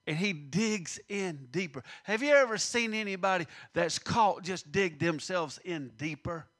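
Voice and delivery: normal talking voice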